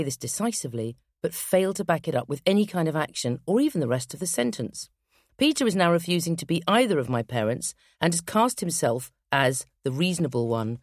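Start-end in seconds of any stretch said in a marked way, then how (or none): none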